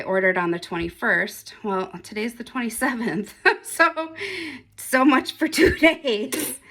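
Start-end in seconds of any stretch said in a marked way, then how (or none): 3.5-6.6 laughing. Real, not acted. Laughing at the late delivery.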